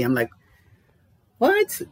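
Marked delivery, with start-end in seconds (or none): 1.4-1.8 high, loud disbelieving echo of her words; incredulous disbelief. Retold or imitated. Telling the host how he reacted, high and incredulous. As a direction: repeat her claim back in shocked disbelief.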